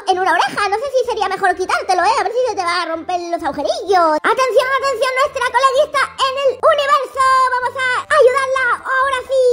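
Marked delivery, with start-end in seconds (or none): 4.2-9.4 mock announcement. Announcing girl in space.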